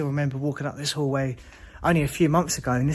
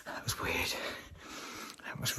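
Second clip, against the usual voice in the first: whisper; 13 dB quieter than usual. Real, not acted. Scared alone in abandoned hospital.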